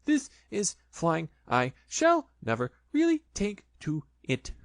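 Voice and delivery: small scared Piglet bouncing in a pocket. Acted. Piglet voice, frightened.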